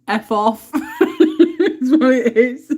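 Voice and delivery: words squeezed out through laughter, slow; can barely speak for laughing; laughing hard. Real, not acted. Repeating Brian's two-word retort, helpless laughter.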